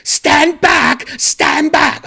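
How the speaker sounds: high, loud imitated British women's shout; yelling. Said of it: "sounded like they were yelling at everyone".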